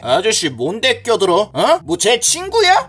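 low-pitched bully menace; surly challenge. Acted. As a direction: tell him off with a low threat. Bully challenges the intervening man. (낮게 깔고 따지는 말투)

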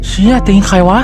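real fright at something on screen; startled shout. Real, not acted. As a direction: jump at the sight of a foot. Sees a strange foot in game.

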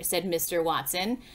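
usual speaking voice

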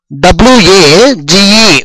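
drawn-out letter-by-letter dictation; slow spelling. Shown as a demonstration. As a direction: slowly spell డబ్ల్యూఏజీఈ one letter at a time. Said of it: "ఇక్కడ జాగ్రత్తగా చూడండి".

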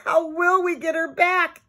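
put-on high, distressed child's wail. A narrator's reading. Susan frets over her lost doll, wailing. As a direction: keep the voice pitched high in dismay. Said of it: "Susan wailed".

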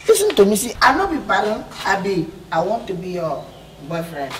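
ordinary talk, nothing marked

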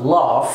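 British laugh; British long A. Shown as a demonstration. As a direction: LAUGH as "lahf". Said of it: "في البريتش إنجلش".

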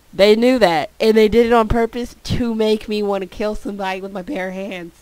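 loud mock-angry rant. Real, not acted. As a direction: rant it at the game. Ranting about the scare.

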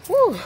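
loud high whew blown out from the heat; hot exhale. Real, not acted. Sun beating down on her.

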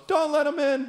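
put-on high-pitched small child's plea; soft little kid. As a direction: lightly imitate a timid kid's voice. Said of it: "soft as a whisper".

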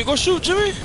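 yelled urging. Real, not acted. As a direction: scream at him to shoot. Urging teammate to shoot, louder.

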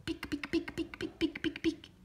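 quick high soft clicks; running heel clicks. A narrator's reading. Narrator imitating heels as the lady runs for the bus. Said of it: "The lady starts to run".

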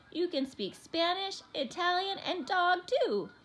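high, loud, lilting praise; delighted praise. A narrator's reading. Mother praising her clever son, delighted. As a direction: lift each language like a proud list.

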